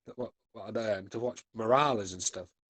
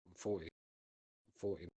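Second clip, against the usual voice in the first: mock gloomy; 5 semitones lower, 14 dB quieter, slower than usual. Mock sad repeat of age.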